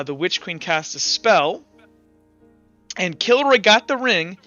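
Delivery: usual speaking voice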